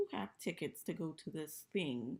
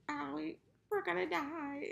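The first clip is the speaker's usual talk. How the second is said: high mock panic; 11 semitones higher, 4 dB louder than usual. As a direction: wail it in hammed-up dread. Retelling the dad's panic.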